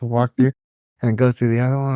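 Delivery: ordinary talk, nothing marked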